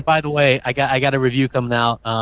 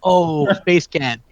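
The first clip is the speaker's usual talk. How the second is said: drawn-out goofy voice; 6 semitones higher, much slower than usual. Pretend. Drawn out far voice.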